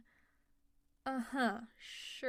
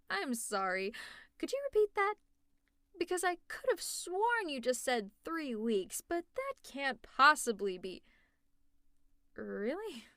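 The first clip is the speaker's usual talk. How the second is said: stunned, pitched up and emphatic; can't believe what you just heard; incredulous; 3 semitones higher, 4 dB louder than usual. Acted. Villain stunned by hero's answer.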